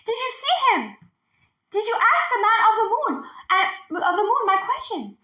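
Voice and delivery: high, excited rush of questions; eager questions. A narrator's reading. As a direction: dragon eagerly pressing for news. Eager dragon asking questions.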